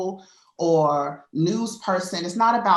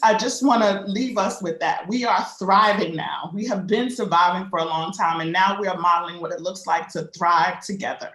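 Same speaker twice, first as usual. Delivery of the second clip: ordinary talk, nothing marked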